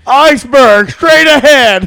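loud, high, slow alarm cry; Titanic lookout shout. Acted. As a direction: bellow the warning from the crow's nest. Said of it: "a quote from fucking Titanic".

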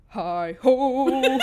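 loud laughing dwarf hi-ho; attempted deep dwarf voice. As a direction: belt it like a dwarf, cracking up. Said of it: "you gotta do it really deep".

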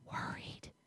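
breathed, unvoiced whisper; barely a breath; still small whisper. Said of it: "still small whisper of the Lord".

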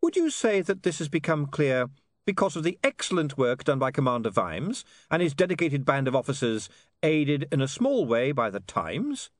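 feed the lord his quote in a lifted voice; polite, pointed interviewer; reporter William, smoothly leading. Acted. William's held character voice.

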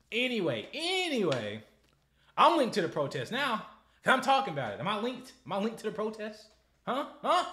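high, loud agitated rant; high loud worked-up ranting. Real, not acted. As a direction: keep the outrage going. Excited ranting after scream.

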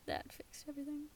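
soft whispered aside; whispering. Real, not acted. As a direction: whisper it conspiratorially.

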